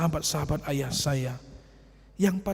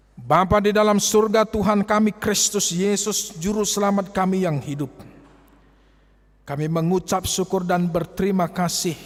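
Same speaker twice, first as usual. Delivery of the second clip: loud, raised prayer voice; fervent prayer; 5 semitones higher, 7 dB louder than usual. Acted. Pastor leading the congregation in prayer, loud and fervent. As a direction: lift the prayer up boldly.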